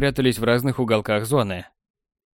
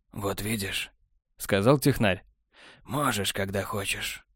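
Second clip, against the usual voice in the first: lower, much softer, cool and smug; cool technician; 3 semitones lower, 7 dB quieter, slower than usual. A narrator's reading. Technar answering Borland, low smug and soft. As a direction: drawl it, unbothered and smug.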